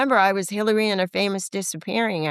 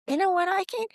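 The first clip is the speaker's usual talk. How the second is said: excited self-talk; 9 semitones higher than usual. Retold or imitated. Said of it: "I go".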